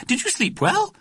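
very high, fussy question; high-pitched motherly enquiry; mother calling out. A narrator's reading. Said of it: "called Missus Smythe Fortescue".

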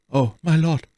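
high, sorrowful sigh of a servant. A narrator's reading. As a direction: sigh it out in dismay, pitched high. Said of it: "seufzte Laporte". (bekümmert seufzend)

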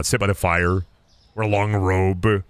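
ordinary delivery

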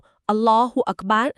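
"อัลลอฮุอักบัร" drawn out slowly like a chanted prayer call; recited takbir. Shown as a demonstration. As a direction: intone "อัลลอฮุอักบัร" slowly and devoutly. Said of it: "ก็ให้กล่าว". (กล่าว "อัลลอฮุอักบัร" ช้าๆ แบบตั้งใจ)

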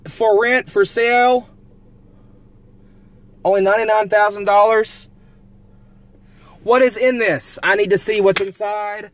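high, loud, eager questioning; eager excitement. Real, not acted. Driver eyeing a cheap haunted house, eager excitement. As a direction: dream out loud about buying it.

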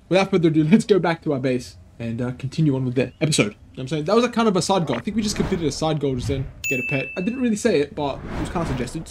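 ordinary talk, nothing marked

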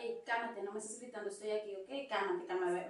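calm him down softly; soft, low hush to a yeller. Acted. Calming the yelling friend.

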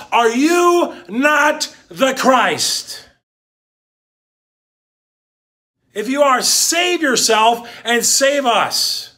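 angry accusation. Retold or imitated. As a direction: throw the question at him in scorn. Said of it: "He says".

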